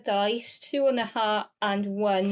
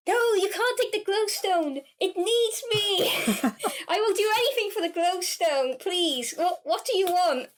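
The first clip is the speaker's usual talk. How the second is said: higher, laughing plea; begging; 8 semitones higher than usual. Acted. Player's character begs pale man for the glowstone, higher, half-laughing. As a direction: beg with a laugh. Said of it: "I'm begging him".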